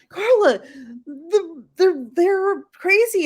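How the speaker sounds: high, whiny imitation of an arguing friend; mock exasperation. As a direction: pitch it up and draw out the complaint. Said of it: "You're like".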